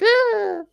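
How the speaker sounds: high imitated death squeal; hammed-up tiny high "ah"; dying spider cry. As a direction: squeal like a spider keeling over. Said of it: "he falls on the floor, and he's like".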